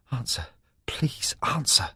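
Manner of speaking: desperate slow whisper; whispered plea. Acted. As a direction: beg under your breath. Frantic redial, begging phone.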